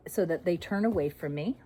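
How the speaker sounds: ordinary delivery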